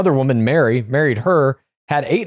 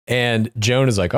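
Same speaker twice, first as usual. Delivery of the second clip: ordinary delivery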